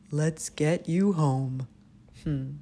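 measured, unhurried hippo. Acted. Hippos taking Albie home, slow loud character voice.

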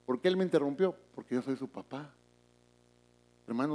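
usual speaking voice

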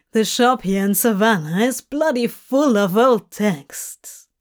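Crowley, wry and lively; a demon griping about the heat; Crowley, grumbling. Acted. Crowley voiced in podfic.

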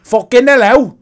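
loud, hammed-up British "Fucking hello"; a rude British Pokemon greeting; mock British accent. Host voices a rude British Pokemon in a mock accent. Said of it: "Is it in British?".

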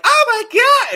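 put-on very high squealy anime girl. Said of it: "exaggerated, like".

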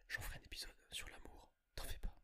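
soft breathy whisper aside. Real, not acted. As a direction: whisper it like a secret. Whispered aside then normal repeat.

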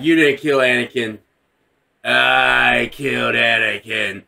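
low, slowing, menacing imitation; slow right down on the confession; mock dark villain voice; grave villain.

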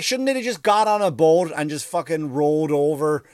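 ordinary delivery

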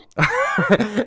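high, amused laugh; burst of laughter. Real, not acted. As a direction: laugh out at the lineup. Laughs after reading the lineup.

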